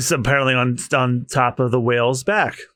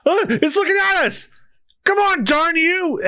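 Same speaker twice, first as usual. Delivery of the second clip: panicked crew; 13 semitones higher than usual. Acted. Crew panicking as whale looks.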